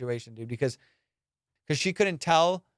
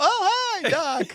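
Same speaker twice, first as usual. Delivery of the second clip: very high, overdone hello; over-eager greeting; slower than usual. Retold or imitated. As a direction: greet the doctor in mock innocence. Caught out, greets doctor.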